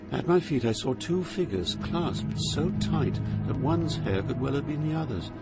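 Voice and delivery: ordinary talk, nothing marked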